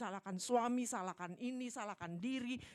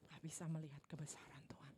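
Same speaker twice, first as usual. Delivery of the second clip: whispered; 4 semitones lower, 9 dB quieter than usual. Acted.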